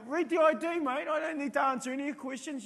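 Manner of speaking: high, loud mock-defiant brush-off; refusing questions, pointing to the ID; defiant to the cop. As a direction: play the tough guy refusing to talk. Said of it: "that's what I feel like saying".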